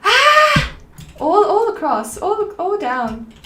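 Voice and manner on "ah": high, loud cartoon scream; car yelling. Imagining cars with vocal cords, a mock car scream. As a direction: shriek like a car speeding down the driveway. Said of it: "yelling when they drive past".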